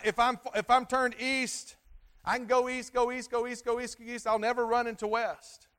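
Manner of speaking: loud, high preaching voice; preaching at full force. Acted. Pastor to congregation, preaching loud and high.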